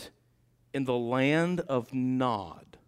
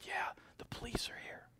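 put-on child's whisper; 10 semitones higher, 14 dB quieter than usual.

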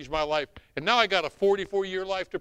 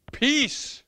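high, loud proclamation; proclaiming; 9 semitones higher, 7 dB louder than usual. Real, not acted. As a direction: ring the word out. Preacher proclaims answer.

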